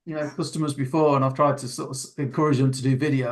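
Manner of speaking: normal talking voice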